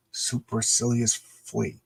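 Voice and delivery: slow, careful sounding out; reading aloud haltingly. Shown as a demonstration. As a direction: sound out each syllable of "Supercilious flea". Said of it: "struggling to read that word balloon".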